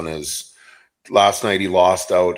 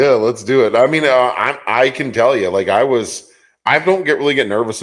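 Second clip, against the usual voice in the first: eager and loud; 6 semitones higher, 6 dB louder than usual. Real, not acted. Eager start to a story.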